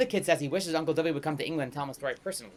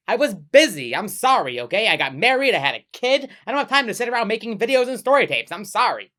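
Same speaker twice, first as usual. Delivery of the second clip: louder, higher defensive rant; defensive outburst; 5 semitones higher, 7 dB louder than usual. Acted. Host fending off the visitor's complaint, flustered loud excuses.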